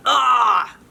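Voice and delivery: loud "ah!" as he flies up; hammed-up falling yell. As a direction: yelp while flung into the air. Charlie Brown flying.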